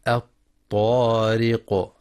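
الطارق with a thin r and echoed final q; الطارق recited slowly, long aa, popped final qaf; light ra, qalqalah. Shown as a demonstration. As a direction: keep the r light and bounce the q of الطارق. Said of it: "لترقيق الراء في كلمة".

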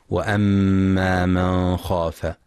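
وأما من خاف with the nun pronounced fully before kha, slow; clear nun. Shown as a demonstration. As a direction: keep the nun of من clear, no nasal hiding. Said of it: "للإظهار الحلقي في من خاف".

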